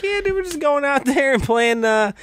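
high, laid-back carny voice imitating the veteran, laughing mid-line; fair tour performer. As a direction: ham up a carefree county fair act. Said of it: "he's like a guy doing, like, a carnival".

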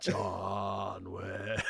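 "John Wick" dropped very low and slow; lightly voiced movie tough guy; low dude voice. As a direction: mumble it low like Keanu. Deep Keanu voice echo.

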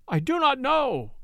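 high, desperate cry of denial; frantic denial. A narrator's reading. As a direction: plead for his life, pitched high. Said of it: "cried Werper".

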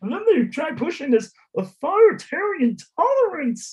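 high-pitched mocking whine, laughing through it; mocking opponent voice. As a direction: put on a high, silly complainer's voice. Mocking complaint voice of political opponents.

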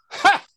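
a single high bark of a laugh, loud; one loud amused "Ha!" in a high pitch; sharp laugh. Retold or imitated. Kenny laughing at the Masters mix-up, a sudden high loud "Ha!".

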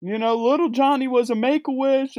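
ordinary talk

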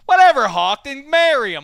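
loud, high, indignant jab; mock jealous. Host jealous of co-host's new best pal, mock-indignant. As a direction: throw it out like a jealous friend. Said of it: "somebody's getting jealous".

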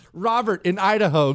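usual speaking voice

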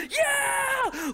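high shrieking crowd yell; crowd scream. Retold or imitated. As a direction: scream it like a whole room cheering.

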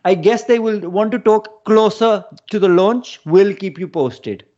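imitated Punjabi English; Punjabi accent. Reading out launch news from wife's text, Punjabi accent. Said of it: "let's hear his accent".

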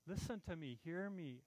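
soft, caring call to listen; gentle voice of Jesus. Acted. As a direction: coax them quietly to listen. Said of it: "Jesus is saying".

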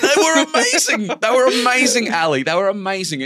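high, loud laugh through the words; can't stop laughing while he says it; giddy laughing memory. Real, not acted. Laughing, remembering the beers.

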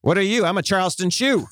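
high-pitched trick-or-treat role-play; mock costume exchange. Comedian acting out Halloween costume chat, mock costume exchange. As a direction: pitch it up, question then proud answer.

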